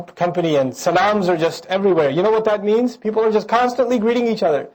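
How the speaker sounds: normal talking voice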